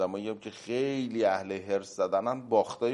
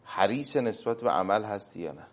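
normal talking voice

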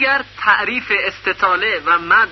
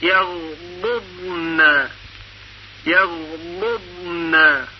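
slow careful recitation; much slower than usual. Shown as a demonstration. Teacher demonstrates two ض in a row. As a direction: recite یغضبن slowly, lifting the repeat. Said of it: "دقت کنید".

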